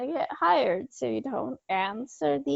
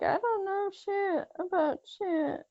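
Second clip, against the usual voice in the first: lightly acted innocent denial, higher and softer; imagined murderer denying; 7 semitones higher, 6 dB quieter than usual. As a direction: play it clueless, pitched up. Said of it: "the person who did the murder would be like".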